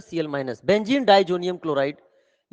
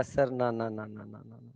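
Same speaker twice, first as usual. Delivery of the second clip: low, fading string of no's; refusing; 7 semitones lower, 11 dB quieter, slower than usual. Acted. Molecule wards off Acid sir before he speaks, softly refusing. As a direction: mutter the refusals, sinking away to almost nothing. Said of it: "कह रहा".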